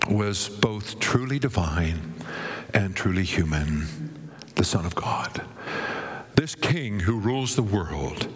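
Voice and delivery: low-pitched, measured sermon delivery; low and weighty, with pauses; solemn preaching. Real, not acted. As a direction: settle into grave, reverent assurance.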